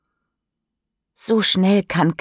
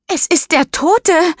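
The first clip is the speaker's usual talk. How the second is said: much higher and louder horrified cry; screaming; 12 semitones higher, 5 dB louder than usual. Acted. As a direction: shriek it in panic. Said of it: "schreit Mischa". (schreiend vor Entsetzen)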